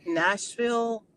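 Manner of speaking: ordinary talk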